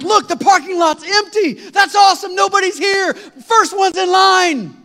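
high, loud and drawn-out glee; overjoyed yell, slowing at the end; excited shout. Retold or imitated. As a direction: crow it like you've won. Thrilled at empty parking lot.

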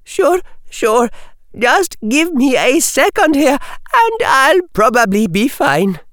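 put-on high girlish character voice; recovering schoolgirl. Parvati asking for a second to recover, high girlish voice. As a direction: play a girl collecting herself, pitched high.

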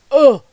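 a loud, weary ugh; exasperated groan. Real, not acted. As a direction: groan at how hard speaking up is. Reacting to pushback being hard.

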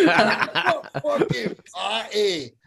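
high, drawn-out Scottish mockery; Scottish accent. Buddy ridicules the fancy food, high mocking Scottish accent. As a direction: imitate a Scot savouring the ridicule, slowly. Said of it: "in the Scottish accent".

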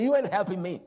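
loud, high re-enacted outburst; acted angry accusation. Driver accusing the calm Holy Ghost beside him, acted angry shout. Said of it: "And I was so mad".

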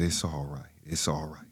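whispered, soft reassurance; soft low whisper of comfort; soothing whisper. Retold or imitated. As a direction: hush him gently. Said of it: "And he said".

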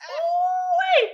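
high laughing shout; real laughing ooh-wee; excited whoop. Real, not acted. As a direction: whoop with delight. Big laughing reaction.

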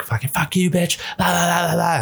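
lightly mimicked high-pitched yelling; mock cursing girls. Man retelling the girls' commotion, mock cursing.

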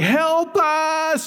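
high, drawn-out mock cry for help; McMullen. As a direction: call out long and high in imitation. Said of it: "In that lovely accent he has".

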